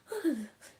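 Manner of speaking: high, exaggerated panting gasps; mock breathless gasps. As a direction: pant as if faking exhaustion. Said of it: "she kept being like".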